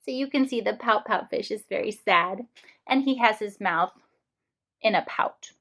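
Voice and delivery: ordinary delivery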